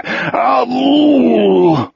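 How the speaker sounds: high, wailing groan of sickness; long retching moan. A narrator's reading. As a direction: moan while throwing up. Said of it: "Wolf moaned and vomited".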